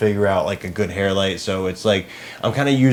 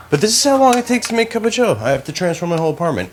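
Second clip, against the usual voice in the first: exasperated high complaint; 10 semitones higher, 5 dB louder, faster than usual. Real, not acted. Frustrated complaint about setup time.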